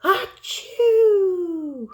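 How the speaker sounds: huge high, loud mock sneeze; big sneeze. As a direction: let rip a giant bear sneeze. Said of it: "makes the bear".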